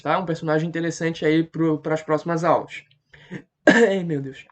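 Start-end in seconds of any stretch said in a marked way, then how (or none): 3.3-4.4 coughing fit, then winded; choking on saliva. Real, not acted. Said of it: "engasguei aqui com a saliva". (engasgado com a saliva)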